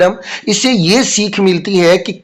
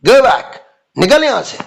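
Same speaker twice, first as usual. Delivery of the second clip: higher, sharp scolding dismissal; scolding teacher; 5 semitones higher than usual. Retold or imitated. Teacher scolds late Raju and sends him away. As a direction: chase the late boy out of class. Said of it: "डांट रही है ना, तो अंदाज भी ऐसे ही".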